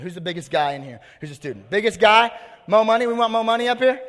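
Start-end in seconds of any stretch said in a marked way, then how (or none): 1.7-4.0 loud, high call into the crowd; raised voice searching the room. Real, not acted. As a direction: call out for a volunteer, pitched up and loud. Calling a volunteer from the crowd.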